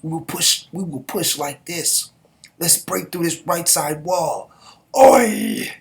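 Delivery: rally the soldiers to push through; rallying the troops. Acted. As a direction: general urging troops forward, building to a shout. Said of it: "Mangekyo was like".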